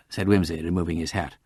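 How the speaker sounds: usual speaking voice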